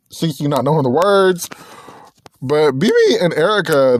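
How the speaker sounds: ordinary delivery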